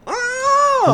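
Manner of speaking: high mock delighted squeal. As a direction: squeal like a happy kid. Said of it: "make ourselves go".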